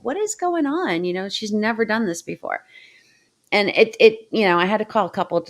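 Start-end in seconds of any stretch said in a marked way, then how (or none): 0.0-1.0 retold bafflement. Retelling bafflement at dog's behaviour.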